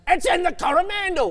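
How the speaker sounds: high mock shout from producers; imitating a shout. Host mimics producers shouting the correct location, high mock shout. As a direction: relay the producers' yell, lightly mocking. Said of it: "shouting at us".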